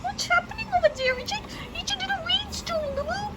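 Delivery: put-on high, sing-song baby voice; baby talk. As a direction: coo at the little one like a parent.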